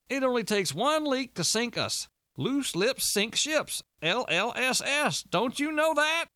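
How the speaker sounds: high-pitched, slow, overdramatic warning; agitated Walter. A narrator's reading. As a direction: lecture in exasperated high-pitched alarm.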